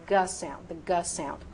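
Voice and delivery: "G" said as "guh"; hard G sound. Shown as a demonstration. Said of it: "Use the hard G sound".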